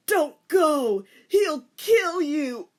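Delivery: high loud, slowing plea; desperate plea. A narrator's reading. Teacher begging Rei to stay, desperate. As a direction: beg her not to go.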